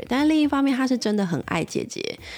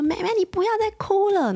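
sharp, much higher and louder scolding; raised voice; 3 dB louder than usual. Retold or imitated. Mom snaps at her crying toddler, loud. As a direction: snap at the crying child to stop. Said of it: "然后声音就开始放大哦".

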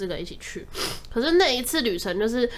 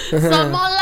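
louder, laughing cry of disbelief; 5 semitones lower, 7 dB louder, slower than usual. Real, not acted. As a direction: ask it through the laughter, outraged. Reacting to the stingy boyfriend story. (边笑边不敢置信地喊)

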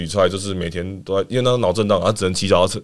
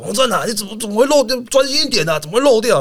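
much higher, nagging coach scold; scolding coach impression; 11 semitones higher than usual. Coach scolding a player for missing the ball, in a high nagging voice. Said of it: "他骂黄正".

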